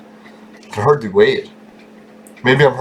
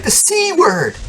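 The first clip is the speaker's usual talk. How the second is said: high prim squeal of the C word; prim lady; slower than usual. Retold or imitated. Lady scolding coworkers over swearing, high scandalized squeal. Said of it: "She's like".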